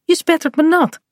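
high-pitched mild protest; warning. A narrator's reading. Bink gets splashed by Kaat jumping in, protesting warning. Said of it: "waarschuwt Pink".

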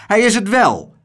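hoog en luid tegenspreken; schreeuwend. A narrator's reading. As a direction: schreeuw het fel terug. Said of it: "schreeuwde zijn vrouw". (luide, hoge schreeuw)

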